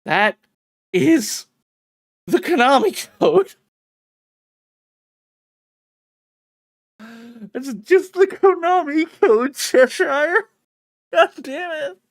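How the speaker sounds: exasperated yelling. Real, not acted. Streamer yelling at the Cheshire Cat's Konami code joke, loud and exasperated. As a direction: yell it out, fed up.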